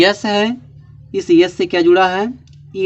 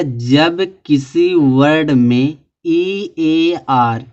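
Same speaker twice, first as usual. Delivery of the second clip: very slow, drawn-out reading of a rule; slow dictation; much slower than usual. Shown as a demonstration. Teacher dictating a spelling rule to students, very slowly. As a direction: spell the rule out word by word so they can copy it. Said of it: "dictating a rule".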